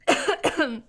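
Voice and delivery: loud choking cough. Real, not acted. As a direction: cough hard to clear the throat. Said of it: "And now I'm choking".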